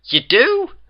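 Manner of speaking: very high, loud rising query; confused question. A narrator's reading. The pair question Twilight's claim, confused surprise. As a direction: squeak it up in total confusion. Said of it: "The two astronauts' confusion".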